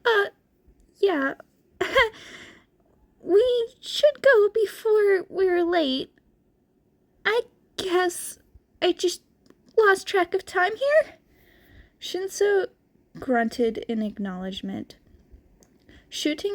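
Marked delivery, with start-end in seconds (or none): 0.0-11.1 put-on high, anxious teenage boy; awkward nervous laugh then hurry; nervous Izuku voice. A narrator's reading. Izuku covering his nerves with Shinso, high and anxious.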